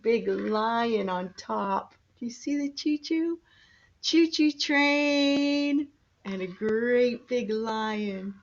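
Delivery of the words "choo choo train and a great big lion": ordinary talk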